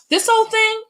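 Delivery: high, dismissive brush-off of the compliment; mock self-deprecating. Shown as a demonstration. Demonstrating the wrong answer to a compliment, brushing it off. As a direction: shrug the praise away in a put-on voice. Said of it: "You go".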